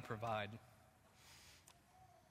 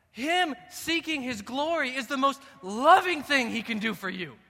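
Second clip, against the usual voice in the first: full-force preaching; 13 semitones higher, 18 dB louder than usual. Acted. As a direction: hammer home God's loving glory.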